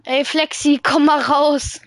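calling out. Real, not acted. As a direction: call a friend out, loudly. Calls friend to come out.

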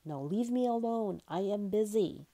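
booming. A narrator's reading. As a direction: dismiss them with a touch of boom. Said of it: "boomed the whale".

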